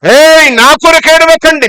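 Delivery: high, forceful reprimand; acted loud rebuke. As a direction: cut the weeping off firmly. Acts Jesus rebuking the weeping women.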